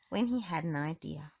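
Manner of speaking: plain reading voice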